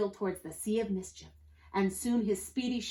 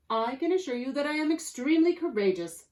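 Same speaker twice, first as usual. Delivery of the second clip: higher-pitched, formal assurance; proper-spoken rascal; 6 semitones higher, 4 dB louder than usual. A narrator's reading. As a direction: promise it very primly. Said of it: "said the rascal".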